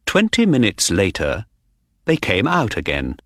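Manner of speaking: usual speaking voice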